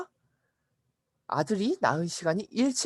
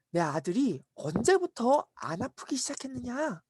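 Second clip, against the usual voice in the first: slow, higher-pitched formal inquiry; questioning master; 6 semitones higher, 3 dB quieter than usual. Acted. Master asks servants when his son recovered, slow and deliberate. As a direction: draw out the careful question.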